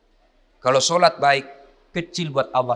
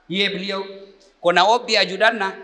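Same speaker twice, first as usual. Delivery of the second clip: normal talking voice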